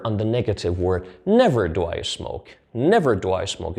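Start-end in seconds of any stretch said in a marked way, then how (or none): 1.2-2.5 Never stressed, the rest falling away. Shown as a demonstration. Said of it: "put a little stress on the word". 2.7-3.8 Never stressed, the rest falling away. Shown as a demonstration. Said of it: "put a little stress on the word".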